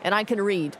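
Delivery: ordinary talk, nothing marked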